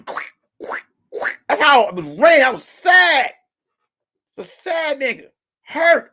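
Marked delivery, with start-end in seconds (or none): 0.0-1.4 whispered squish. Retold or imitated. Sneakers squishing in rain.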